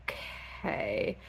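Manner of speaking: a tiny whispered okay; meek whisper. Retold or imitated. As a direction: breathe it out, cowed. Feyre meekly agrees to task.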